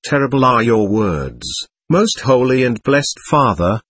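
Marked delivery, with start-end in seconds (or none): none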